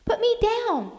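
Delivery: put-on very high child's protest; squirming child screaming in protest. A narrator's reading. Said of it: "Janie screamed".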